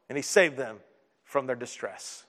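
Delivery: low, soft, slowing to a fade; hushed resolution. A narrator's reading. Preacher telling of the sailors' rescue, hushed. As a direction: drop right down in relief.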